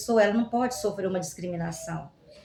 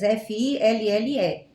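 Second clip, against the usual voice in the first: ordinary talk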